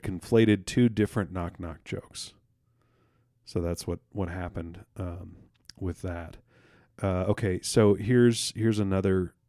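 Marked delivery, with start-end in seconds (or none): none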